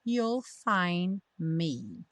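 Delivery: slow, drawn-out model words; pronunciation practice. Shown as a demonstration. Teacher leading learners through lyrics, slow pronunciation practice. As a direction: hold each word long for learners. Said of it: "pra prática da pronúncia".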